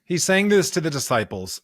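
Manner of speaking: ordinary delivery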